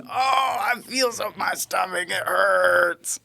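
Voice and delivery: high, slow, strained moan; patient's moan. Retold or imitated. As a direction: groan it out like the patient. Patient moaning again.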